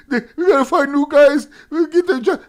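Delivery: loud, high, laughing through the words; real cackling laughter breaking up the sentence; laughing hard. Real, not acted. Ridiculing a troll on stream, helpless loud laughter. Cracking up while mocking John.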